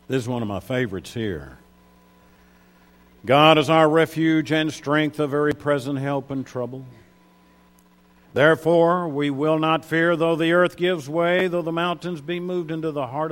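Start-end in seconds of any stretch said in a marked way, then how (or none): 3.3-13.3 louder, proclaiming reading; declaimed scripture. Acted. Preacher reading Psalm forty-six to the church, declaiming.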